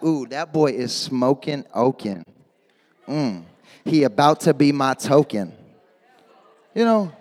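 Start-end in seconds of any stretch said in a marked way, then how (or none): 0.0-5.5 lower, soft, slow swoony murmur; swooning crush. Retold or imitated. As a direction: purr it like you're smitten with him. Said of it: "you were like".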